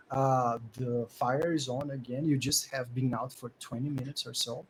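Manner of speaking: ordinary talk, nothing marked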